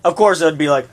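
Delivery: ordinary talk, nothing marked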